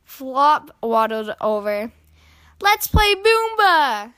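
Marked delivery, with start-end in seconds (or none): 2.6-4.1 put-on high, loud childlike invitation; high-pitched excited kid voice. A narrator's reading. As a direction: pipe up like an eager little brother. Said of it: "said Flop excitingly".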